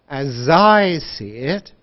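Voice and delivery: "As I see it" stretched slowly with "as" sliding into "I"; linked words. Shown as a demonstration. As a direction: draw out the linked "zai", rising end. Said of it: "We say".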